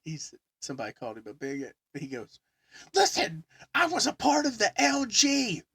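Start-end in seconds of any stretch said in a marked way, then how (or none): 2.9-5.6 high, loud indignant rant; retold porch yelling. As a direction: rant like an offended old friend. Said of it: "he's on my porch yelling this shit".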